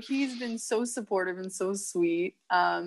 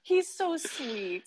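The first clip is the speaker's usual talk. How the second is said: slower, higher and gently fond; 7 semitones higher, 3 dB quieter, slower than usual. Real, not acted. Guest gushing about her boyfriend, slow and fond. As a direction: melt a little over him.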